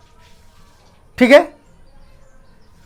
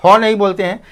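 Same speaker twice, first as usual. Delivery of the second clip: usual speaking voice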